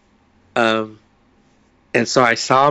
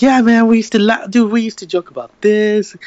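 higher and louder, pushing hard; insistent retold guy; 8 semitones higher, 5 dB louder than usual. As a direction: press him to remember, getting worked up.

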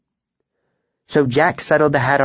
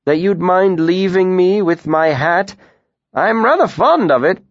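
a higher, wheedling tinker; reluctant; 9 semitones higher, 4 dB louder than usual. A narrator's reading. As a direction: ask unwillingly for the hat. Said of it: "the tinker said reluctantly".

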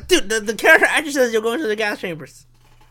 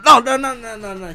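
higher, rapid-fire string of objections; mock frantic denial; 4 semitones higher than usual. Podcaster acts out denying the recording is his, mock frantic. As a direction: pile up pretend protests.

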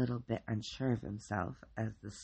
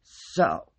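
lightly voiced tough guy; a hint of a macho bruiser; 10 dB louder than usual. A narrator's reading. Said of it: "his best tough guy voice".

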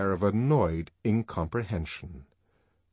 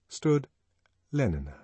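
plain reading voice

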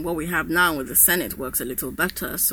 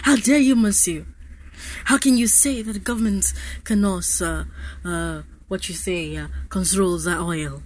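put-on French accent.

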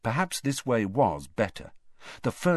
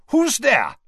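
higher, louder, suspicious challenge into the dark; 9 dB louder than usual. Acted. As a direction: demand it of the shadows, distrustful. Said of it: "very suspiciously".

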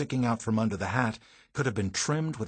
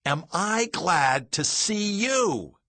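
loud, high and drawn out; overjoyed exclamation; 8 semitones higher, 4 dB louder, slower than usual. Retold or imitated. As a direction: stretch it out with huge relief. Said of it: "I exploded".

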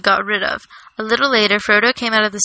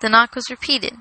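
usual speaking voice

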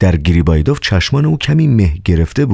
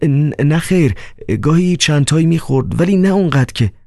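stammering Riukhin; 11 semitones higher than usual. Acted.

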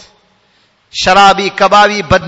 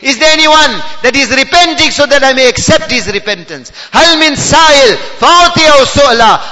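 rising, impassioned calling out; full-force preaching; 7 semitones higher, 5 dB louder, faster than usual. Real, not acted. Preacher relaying Allah's nightly call to the needy, full-force preaching. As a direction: call it out to the whole crowd.

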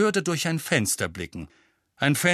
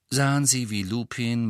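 plain reading voice

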